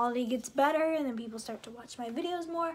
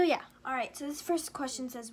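ordinary talk

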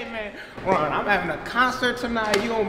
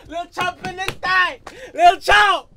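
hype call; 9 semitones higher, 3 dB louder, slower than usual. Acted. Hyping himself to the camera.

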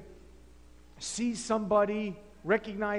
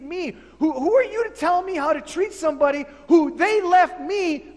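loud, high, offended arguing with Paul; indignant protest; 4 semitones higher, 4 dB louder than usual. Acted. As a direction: bristle and push back hard. Philemon argues with Paul.

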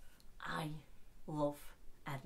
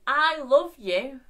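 high, loud, slow cry of love; shouting I love you to her husband; shouted love; 8 semitones higher, 15 dB louder, much slower than usual. Retold or imitated. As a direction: bellow it across the ward. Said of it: "And she shouts".

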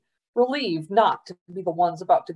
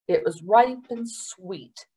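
plain reading voice

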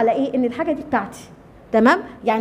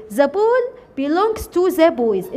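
very slow, word by word; teacher dictating the English sentence very slowly; slow dictation; much slower than usual. Shown as a demonstration. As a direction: spell it out slowly for the class.

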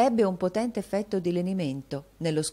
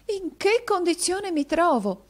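very high, loud, slow lament; drawn-out theatrical outcry; exclaiming; 6 dB louder than usual. A narrator's reading. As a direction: exclaim like a melodramatic heroine. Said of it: "esclamò la signorina Squeers".

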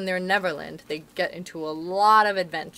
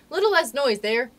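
calling out; 6 semitones higher, 6 dB louder than usual. A narrator's reading. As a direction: call over the noisy children. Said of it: "Peter called out".

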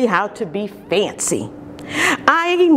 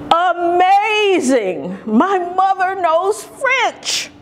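amazed; 8 semitones higher than usual. Acted.

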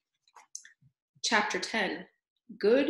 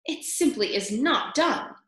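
higher, prim disapproving aunt; disapproving auntly voice; Aunt Florence; 5 semitones higher than usual. A narrator's reading. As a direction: pronounce it a scandal. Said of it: "came the distinctive voice".

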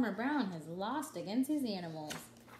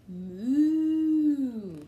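cow moo; 3 semitones higher, 11 dB louder than usual. Acted. Cow sound in read-aloud book.

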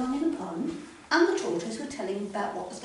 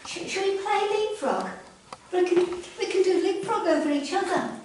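high, eager frog pleading; little frog voice; 9 semitones higher, 10 dB louder than usual. Acted. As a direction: chirp it out hopefully. Freddy suggests leapfrog.

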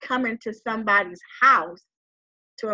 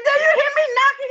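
high, loud, fast disbelieving question; incredulous outburst; 7 dB louder, faster than usual. Retold or imitated. To the man sitting on the couch, high and fast in disbelief. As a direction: blurt it out in disbelief.

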